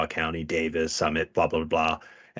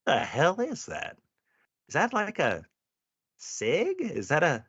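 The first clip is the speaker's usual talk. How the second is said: high, slow puzzled squint at a gun; mock bafflement, drawn out; baffled; 9 semitones higher than usual. Cop stares at a stranger's pistol, high and baffled. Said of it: "I'm like".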